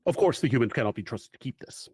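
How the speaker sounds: lightly voiced, solemn mission briefing; measured storyteller laying out the mission; game master's briefing. GM scene briefing narration.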